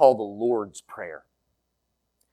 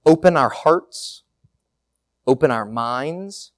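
earnest, steady prayer voice; spoken prayer; 4 semitones lower than usual. Acted. Pastor praying aloud for the congregation, earnest. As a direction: address God with gratitude, building on the repeated open.

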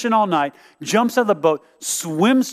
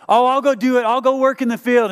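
eager promise; 8 semitones higher, 5 dB louder than usual. Retold or imitated. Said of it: "he was the son that said".

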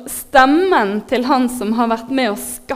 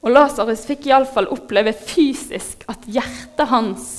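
normal talking voice

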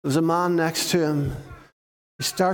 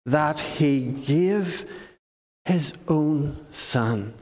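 slow solemn emphasis; 3 semitones higher, slower than usual. Acted. Preacher telling children how much God loved the world, slow and solemn. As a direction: draw out God's love word by word.

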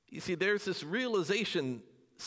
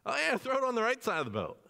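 quick, higher, offhand suggestion; breezy advice; 7 semitones higher, faster than usual. Retold or imitated. Jesus telling the disciples where to cast, breezy and fast. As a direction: toss it off like it's obvious.

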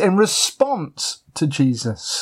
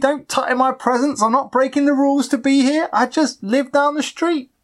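high mock-pleading voice; pleading local; 11 semitones higher than usual. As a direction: plead much higher, hammed-up. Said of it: "they plead".

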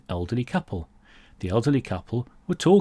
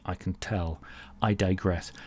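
ordinary delivery

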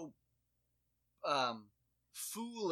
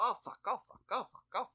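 put-on high, loud little-kid chant of oh fuck; sing-song it like a toddler who loves the word; toddler cursing; 10 semitones higher, 5 dB louder, faster than usual. Parent imitating toddler daughter's swearing, high and sing-song. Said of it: "she'll just walk around and go".

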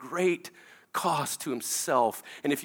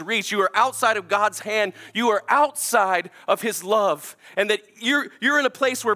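preaching at full force; 8 semitones higher, 6 dB louder than usual. Acted. Altar call to the congregation.

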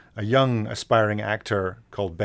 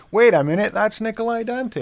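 high, loud mock yell of recognition; retold scream; 13 semitones higher, 7 dB louder than usual. People yelling at the artist about a lookalike, retold scream. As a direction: imitate someone yelling out in sudden recognition. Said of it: "screamed to me very loudly".

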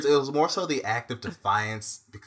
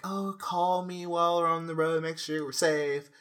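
higher, drawn-out mock-parent plea; imitated fussing parents; 7 semitones higher, 3 dB louder than usual. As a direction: parrot a parent's usual parting reminder. Imitating parents' usual parting request.

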